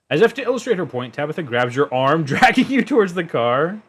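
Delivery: lively, high narrating voice with a laugh; story narration. A narrator's reading. GM narrates scene.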